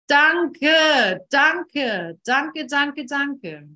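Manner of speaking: loud, high burst of thanks, easing off; effusive thanks. Acted. Speaker thanking a difficult relationship, effusively grateful. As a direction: pour out gratitude, starting big and tapering.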